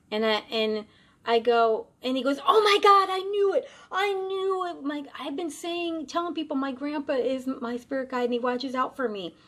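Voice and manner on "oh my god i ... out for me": much higher, loud excited outburst, then calming; overjoyed realization. Retold or imitated. Marine learning his grandpa guides him, high excited rush. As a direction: shout it like your hunch came true.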